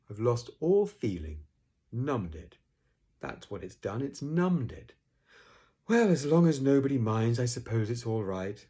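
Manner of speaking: loud, mournful donkey complaint; Eeyore, aggrieved. Acted. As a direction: lament it, then give in grudgingly.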